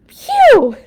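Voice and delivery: very high, loud stinky gasp; phew. Acted. Papa reacting to the skunk smell, very high and loud. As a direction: blast out a disgusted phew.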